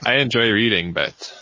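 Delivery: normal talking voice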